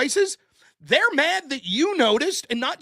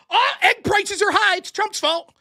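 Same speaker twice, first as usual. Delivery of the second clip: higher, louder mocking whine; mock outrage; 8 semitones higher, 6 dB louder than usual. Pundit mocks Democrats blaming Trump for eggs, higher, louder mock outrage. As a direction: mimic a hammed-up blaming cry, higher and louder. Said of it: "they're like".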